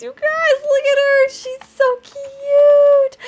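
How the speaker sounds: loud, very high gushing; real overflowing cuteness squeal; adoring squeal. Real, not acted. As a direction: squeal and stretch the final word. Gushing over the dragon.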